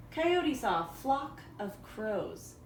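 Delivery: plain reading voice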